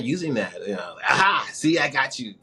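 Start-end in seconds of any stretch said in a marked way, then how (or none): 1.1-2.3 triumphant gotcha. Retold or imitated. Playfully catching New Yorkers' accent out.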